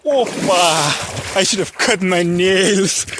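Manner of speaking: put-on hamming a touch of Eastern European accent; mock Eastern European accent. Vlogger crossing a pond, playfully loud mock Eastern European accent. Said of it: "the word nails with a Eastern European accent".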